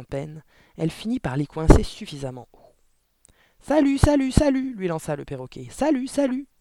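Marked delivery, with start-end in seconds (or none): 3.6-4.7 high, loud parrot voice; parrot squawk. A narrator's reading. Parrot greeting Mathilda from the chimney, loud high squawk. 5.7-6.5 high, loud parrot voice; parrot squawk. A narrator's reading. Parrot greeting Mathilda from the chimney, loud high squawk.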